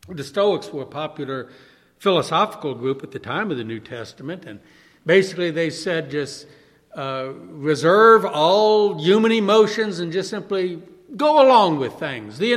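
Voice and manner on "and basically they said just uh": normal talking voice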